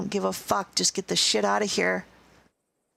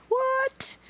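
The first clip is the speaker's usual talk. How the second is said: mock surprise. Sudden squeak of mock surprise.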